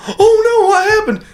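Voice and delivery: high, loud, hammed-up worried voice; playful high-pitched mock panic; mock alarmed hunter.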